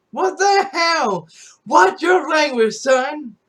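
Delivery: retold outburst at mom. Son yells at mom for scolding him, exasperated yelling. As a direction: blow up at her for breaking the deal. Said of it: "I'll be like".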